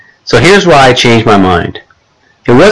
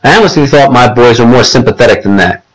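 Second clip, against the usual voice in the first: ordinary delivery, nothing marked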